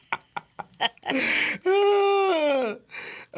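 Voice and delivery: high-pitched laughing fit; long laugh. Real, not acted. Host stumped by a fact-or-cap game, high long laugh. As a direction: crack up helplessly, high and drawn out.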